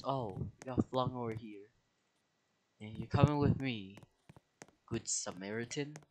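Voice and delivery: ordinary talk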